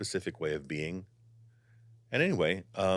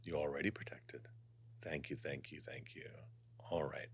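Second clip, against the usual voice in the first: guided meditation; 5 dB quieter than usual. Acted. Guiding viewers to relax before channeling, soft meditation voice. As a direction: ease the listeners into calm.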